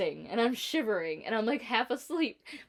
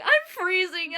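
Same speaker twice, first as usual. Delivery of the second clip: higher and louder, mock pitiful; retold complaint; 10 semitones higher, 7 dB louder, slower than usual. Telling the sweating boss she is freezing, higher and louder. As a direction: insist you are freezing. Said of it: "And I'm like".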